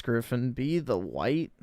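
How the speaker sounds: ordinary talk, nothing marked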